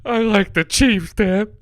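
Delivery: high, slow mock-crying kid; exaggerated tearful child impression; sobbing son. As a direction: wail it like a kid confessing to dad. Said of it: "And he's sobbing, crying".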